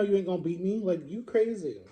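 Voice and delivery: ordinary talk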